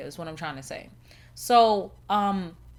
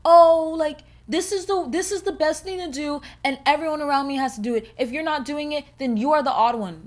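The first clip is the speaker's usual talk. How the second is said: high-pitched mock enthusiasm; performative follower; 5 semitones higher, 6 dB louder than usual. Trend followers telling others to join in, mockingly. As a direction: mimic people pushing the trend on everyone. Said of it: "where people are like".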